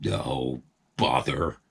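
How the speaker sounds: low, glum mutter; resigned farmer sigh-word; sulky grumble. A narrator's reading. Farmer giving in, grumbling low.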